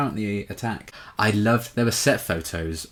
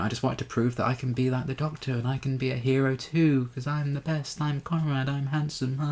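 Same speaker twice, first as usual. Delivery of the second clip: high, smug show-off bragging; cocky Conrad; 7 semitones higher than usual. Retold or imitated. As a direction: preen like a vain hero. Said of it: "he kind of plays it off as".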